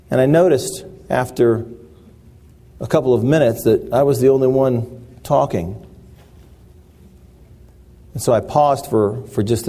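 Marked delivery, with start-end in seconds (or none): none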